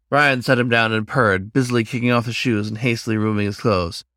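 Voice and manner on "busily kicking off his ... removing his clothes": ordinary delivery, nothing marked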